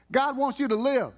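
preacher raising his voice. Real, not acted. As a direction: lift the voice to the crowd. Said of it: "me to just raise my voice a little bit?".

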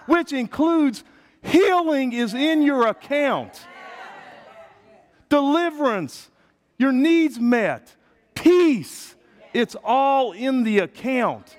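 high, loud, slow-punched preaching; full-force preaching. Acted. Pastor rousing the congregation, high and loud. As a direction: rouse the room with it.

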